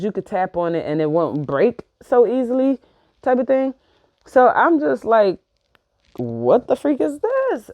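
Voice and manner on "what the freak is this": high-pitched, drawn-out disbelief; retold freak-out. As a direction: act out a slow, bewildered freak-out. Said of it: "I'm all, like, freaking out".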